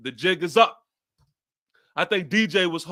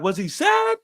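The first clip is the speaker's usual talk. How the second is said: put-on much higher, small child's innocent question; imitating his little son; 13 semitones higher than usual. Dad voicing his six-year-old son's question, high childlike voice. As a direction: play the curious little boy asking about the quarterback. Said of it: "He was like".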